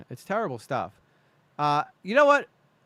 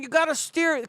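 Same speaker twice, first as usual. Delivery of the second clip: higher, raised frustrated coaching; frustrated instruction; 10 semitones higher than usual. Retold or imitated. Parent teaching daughter to ride, higher frustrated call. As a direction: urge her to steer, voice pitched up. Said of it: "I'm like".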